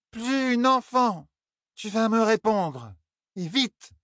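high, very slow, dragged insistence; slow stubborn drunk Elaine. Acted. Elaine repeating to Tom, slow and stubborn, high. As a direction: stretch each word out stubbornly.

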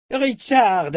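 Richard! called out high and loud; urgent shout. A narrator's reading. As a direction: shout his name in warning. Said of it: "répéta-t-il".

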